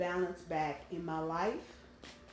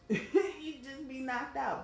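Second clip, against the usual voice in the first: slow, laughing aside, pitched up; amused laughing; 7 semitones higher, slower than usual. Real, not acted. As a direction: chuckle into the words, higher. Chuckles at own remark about sleeping.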